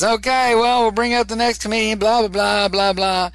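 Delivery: high, loud hammed-up emcee voice; mock emcee. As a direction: bring up the next act like a bored host. Emcee bringing up next comic.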